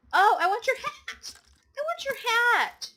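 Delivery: high, loud, drawn-out squeal of wanting; excited yell. Real, not acted. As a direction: cry out greedily for the prize. Excited at seeing wanted game item.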